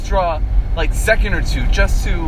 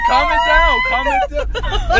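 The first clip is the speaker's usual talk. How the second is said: shouting to calm down; slower than usual. Real, not acted. Driver calming after near crash.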